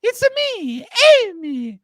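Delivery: high singsong mock Italian accent; playful fake Italian accent. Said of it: "when you guys hear that voice of".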